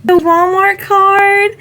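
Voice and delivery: high, loud and drawn-out; delighted squeal. Real, not acted. Nurse finding chocolate in her gift, delighted squeal. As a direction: stretch it in pure delight.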